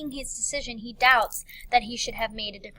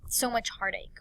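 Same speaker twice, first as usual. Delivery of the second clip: regretful sigh. Acted. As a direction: act out wistful regret. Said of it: "a sigh that is bad".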